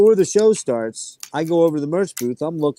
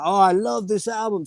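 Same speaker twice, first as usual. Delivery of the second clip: lightly imitated French Canadian, pitched higher; French Canadian accent; 6 semitones higher than usual. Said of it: "That's my French Canadian".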